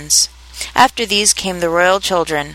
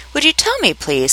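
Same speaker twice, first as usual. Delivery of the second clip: timid; 8 semitones higher than usual. A narrator's reading. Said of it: "a little timidly".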